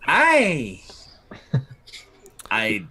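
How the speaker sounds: drawn-out, swooping character voice; hammed-up self-praise in a put-on voice; Madame Dimitri. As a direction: relish it slowly, pitch rising and falling. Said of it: "Madame Dimitri gives you a look".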